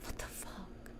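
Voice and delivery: stunned unvoiced whisper. Real, not acted. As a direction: breathe it out in disbelief. Shocked by creepy dictionary voice.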